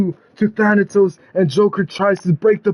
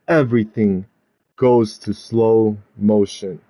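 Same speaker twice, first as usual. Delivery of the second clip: low, slowed-down suspense; 7 semitones lower than usual. A narrator's reading. Storyteller slows the final pin, dragging and low. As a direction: drag each word as if time slows. Voice mimics slow motion.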